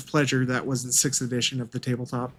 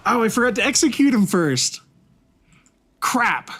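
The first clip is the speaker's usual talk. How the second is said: sudden loud dismay; dismayed outburst; 8 semitones higher, 5 dB louder than usual. Real, not acted. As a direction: blurt it out, caught off guard. Forgot to execute the prince.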